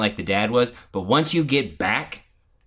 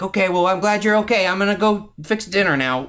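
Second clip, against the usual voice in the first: quick, higher imitation of breezy relief; mock casual parent; 6 semitones higher than usual. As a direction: brush it off and move straight on. Parodies a too-casual parent.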